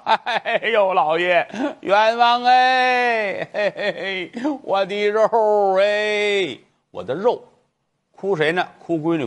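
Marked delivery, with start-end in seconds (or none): 0.0-7.4 put-on high, slow comic old woman's wail; hammed-up wailing. Old mother wails to the magistrate, hammed-up crying. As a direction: wail it out, mock sobbing. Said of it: "老太太哭得都不行了".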